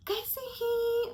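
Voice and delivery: Kaise hi? lifted high and drawn out sweetly; mock polite. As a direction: ask Kaise hi? in a high fake-friendly tone. Narrator asks aunt how she is.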